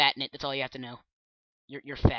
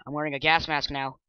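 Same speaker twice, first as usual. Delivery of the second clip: normal talking voice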